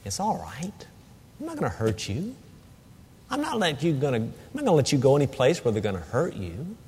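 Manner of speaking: soft, hushed comfort for a trembling pet; quiet reassuring murmur; soothing a scared dog. Retold or imitated. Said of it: "I'm saying to her".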